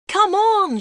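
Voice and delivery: high, loud cheering shout; excited fan shouting at the TV. A narrator's reading. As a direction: yell it at the players on the pitch. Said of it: "shouted Peter".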